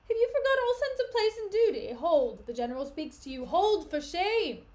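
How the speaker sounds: loud, high, slowing to end; angry shouted order; shouted rebuke. Acted. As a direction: shout them down. Iago ordering the fighters to stop.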